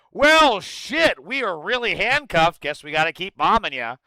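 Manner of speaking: loud sarcastic shrug, falling from high to normal; exaggerated fake resignation, starting loud; mock helplessness. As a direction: imitate a feigned helpless excuse. Mocking the Democrats' reply.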